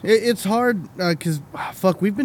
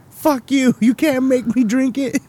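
mock defiant yell; 8 semitones higher than usual. Mocking friend refusing drink.

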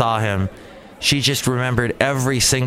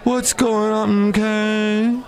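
high, slow put-on "Hey, man"; mock caller voice; 10 semitones higher, much slower than usual. Imitating an annoying caller, high and slow. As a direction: imitate the guy in a higher voice. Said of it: "Getting that all week".

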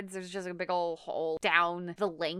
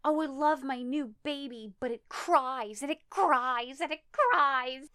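higher put-on voice, drawing out each repeat; dramatic diary reading; 6 semitones higher than usual. Wife reads the old diary aloud, building melodrama. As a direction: read the diary in an overwrought higher voice. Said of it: "And she starts reading it".

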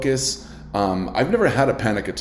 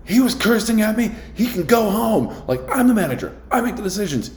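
shrill-sounding boss repeating himself; angry manager; 12 semitones higher than usual. Retold or imitated. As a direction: manager ranting about who makes decisions. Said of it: "He must have said that, like, ten to fifteen times".

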